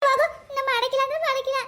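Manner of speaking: angry refusal. Acted. Wife tells husband she will not cook, angry. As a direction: fire back a stubborn no.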